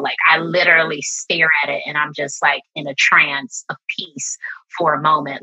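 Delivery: ordinary delivery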